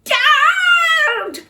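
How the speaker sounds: very high, loud cry for Dad; desperate child screaming. Acted. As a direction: wail it into the night. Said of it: "screamed Peter".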